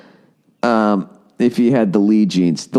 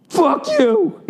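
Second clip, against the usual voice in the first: very high, screeched parting insult; hammed-up shrill yell at the end of a tailgate spat; mock yelled insult. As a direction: fling it back over your shoulder in a shriek. Stranger's parting insult imitated.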